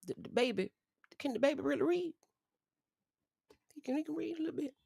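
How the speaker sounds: very high, soft voice; high, hesitant and hushed; delicate doubt. Real, not acted. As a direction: squeak the question out gingerly, softly. Delicately doubting someone can read.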